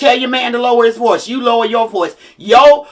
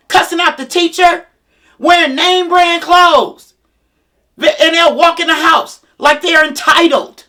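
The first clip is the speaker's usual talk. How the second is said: fired-up rant; 5 semitones higher, 3 dB louder than usual. Acted. Venting to parents about spoiled kids, loud and high. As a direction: lay into it, stretching the outrage.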